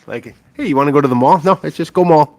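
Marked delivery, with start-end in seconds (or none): 1.4-2.4 higher-pitched U P accent. Shown as a demonstration. Showing how northerners drop words, U P accent. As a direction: demonstrate clipped-down U P phrasing for "go mall". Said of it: "that's my U P accent".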